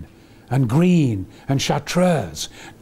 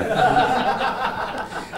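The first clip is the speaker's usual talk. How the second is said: high hearty laugh; 10 semitones higher than usual. Real, not acted. Big laugh after story.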